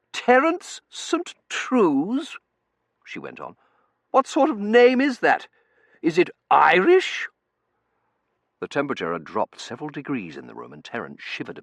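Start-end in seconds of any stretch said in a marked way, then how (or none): 0.1-7.3 haughty lady voice. Acted. Mrs Meering character voice.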